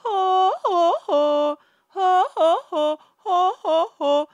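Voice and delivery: high falsetto oh, breaking like the singer; imitating his falsetto flips. Vocal coach imitating the singer's flips, high falsetto oh. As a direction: mimic the little voice breaks on oh. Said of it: "para que le salga ese oh".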